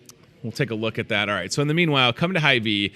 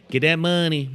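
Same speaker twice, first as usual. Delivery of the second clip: put-on voice; 5 semitones higher than usual. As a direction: ham it up like a hustler chasing cash. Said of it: "I don't know why you talk like that".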